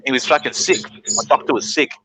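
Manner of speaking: ordinary talk, nothing marked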